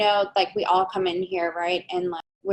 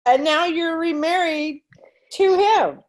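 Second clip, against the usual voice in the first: incredulous; 7 semitones higher, 6 dB louder, slower than usual. Real, not acted. Host's incredulous recap of the remarriage.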